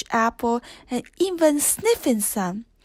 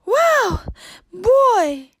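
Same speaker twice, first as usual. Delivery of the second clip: much higher, louder surprised yelp; startled cry; 9 semitones higher, 4 dB louder than usual. A narrator's reading. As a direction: cry out as the horse bolts. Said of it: "Belle cried".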